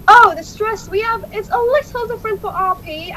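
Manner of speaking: high-pitched Received Pronunciation; RP accent. Shown as a demonstration. Showing how RP speakers differ from British, high RP accent. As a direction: lift the voice into British RP. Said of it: "they speak kind of like this".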